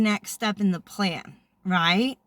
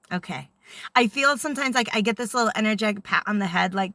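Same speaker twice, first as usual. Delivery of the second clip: usual speaking voice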